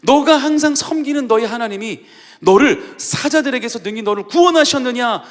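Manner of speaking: high, loud call full of certainty; king crying out in faith at the den; confident cry of faith. Retold or imitated. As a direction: call out expecting an answer. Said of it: "믿음에 찬 확신이에요".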